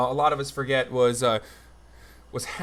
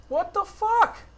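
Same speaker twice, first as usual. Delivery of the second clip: a much higher, squeaky what the fuck; disbelieving squawk; slower than usual. Real, not acted. Streamer hitting another paywall, in a squeaky outraged yelp.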